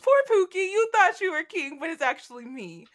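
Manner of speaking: high, loud mocking baby talk; mock pity. [name] taunting Nick about being king, mock pity. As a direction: coo at him condescendingly.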